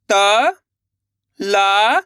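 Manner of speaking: "त", pause, "ला", each held long; letter by letter. Shown as a demonstration. Teacher leads pupils to repeat, drawn-out separate "त", "ला". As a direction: sound out "त" and "ला" separately. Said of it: "उनका उच्चारण करना है".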